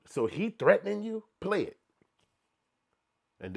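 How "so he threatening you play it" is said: high, loud eager question; eager mock lawyer, high and loud; mock excited lawyer. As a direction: act the lawyer smelling money. Mock lawyer hearing tape.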